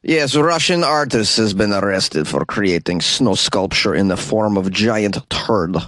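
slow, heavy mock Russian accent; hammy fake Russian accent. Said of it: "this terrible Russian accent".

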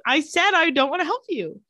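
high, put-on insistent voice; insistent Elena. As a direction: repeat it high and put-upon. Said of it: "voicing Elena refusing Esther".

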